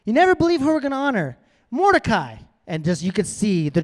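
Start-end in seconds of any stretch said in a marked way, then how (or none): 0.1-2.4 quick, high-pitched delighted announcement, then the name; excited reveal. Acted. King springs the honoree's name on Haman, excited. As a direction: build up to the big name reveal.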